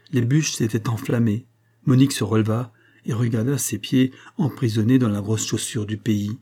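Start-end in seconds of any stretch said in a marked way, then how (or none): none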